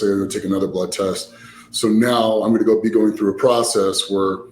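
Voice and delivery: ordinary delivery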